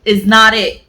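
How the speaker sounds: loud, high, fed-up rant; mad rant. Real, not acted. Vlogger rants to viewers about the hyped book, loud and angry. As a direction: vent like you've been betrayed. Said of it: "I am so mad".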